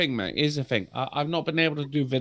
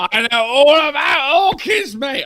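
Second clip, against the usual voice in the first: excited shout; 9 semitones higher, 5 dB louder than usual. Real, not acted. Boasting about orchid knowledge.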